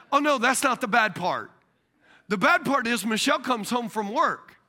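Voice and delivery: ordinary talk, nothing marked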